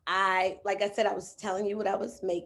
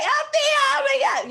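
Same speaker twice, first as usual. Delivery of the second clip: loud, high shriek; frantic call to her coach; panicked shout; 10 dB louder than usual. Retold or imitated. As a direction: wail her name in panic. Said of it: "I was like".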